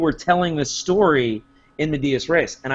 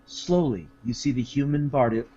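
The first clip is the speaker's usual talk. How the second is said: imitating a game master reading; hushed dramatic reading; 7 semitones lower, 8 dB quieter than usual. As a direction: read it out like solemn flavor text, low and soft. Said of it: "he was doing a good job reading".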